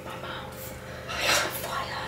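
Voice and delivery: breathless pained whisper. Real, not acted. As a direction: whisper it, mouth burning. Mouth burning, whispered aside.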